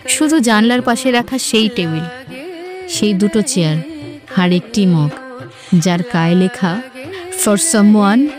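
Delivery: ordinary delivery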